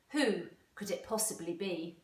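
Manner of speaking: usual speaking voice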